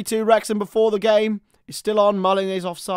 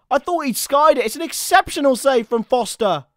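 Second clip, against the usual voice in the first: ordinary talk, nothing marked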